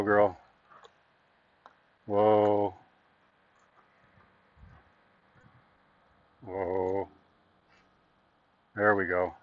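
soft low calming whoa; soothing a horse. Shown as a demonstration. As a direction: settle the mare gently. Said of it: "I'll hold the lines just still".

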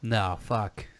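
ordinary talk, nothing marked

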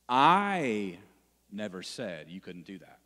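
I shouted, never said you couldn't do that falling away; stress on I. Shown as a demonstration. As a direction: hit I hard, then drop the rest. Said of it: "But how I say it is gonna change".

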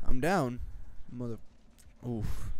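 usual speaking voice

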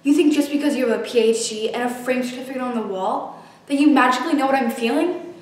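bitter, rising fury; angry outburst. Acted. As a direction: lash out in anger. Furious outburst at therapist.

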